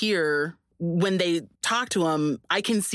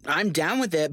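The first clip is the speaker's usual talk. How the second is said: normal talking voice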